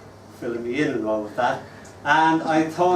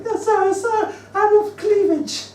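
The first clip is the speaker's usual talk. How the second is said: put-on much higher, louder eager little boy; 5 dB louder than usual. Little boy answering the teacher, high and proud. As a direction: proudly give the wrong answer. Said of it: "There was one little guy".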